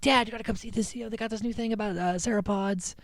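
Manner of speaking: put-on high-pitched eager boy voice; excited kid. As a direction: imitate a son eagerly calling Dad over. Acts out boy excitedly calling dad.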